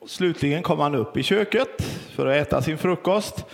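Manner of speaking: ordinary talk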